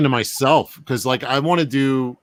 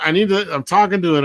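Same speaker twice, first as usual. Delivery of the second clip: usual speaking voice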